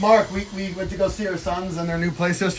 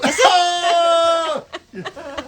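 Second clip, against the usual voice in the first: loud shrieking laugh; 12 semitones higher, 5 dB louder than usual. Real, not acted. Laughing hard.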